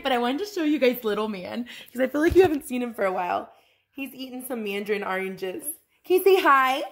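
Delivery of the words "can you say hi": higher, louder sing-song coaxing. Real, not acted. Mother coaxing toddler to talk.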